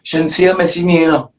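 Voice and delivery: very high-pitched imitation of people talking; mock excited voice. Interviewee mimicking people who compare his missus to [name], high mock voice.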